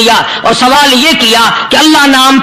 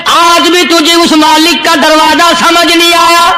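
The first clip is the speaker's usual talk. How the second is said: higher, emphatic pulpit voice; impassioned sermon voice, higher; preaching; 4 semitones higher than usual. Acted. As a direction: drive the point home to the congregation.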